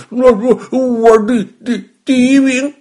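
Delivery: put-on high, loud, garbled kid voice; muffled child's boast round stuffed cheeks; mouth stuffed full. A narrator's reading. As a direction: stammer through a huge mouthful of food. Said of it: "连话都说不清了".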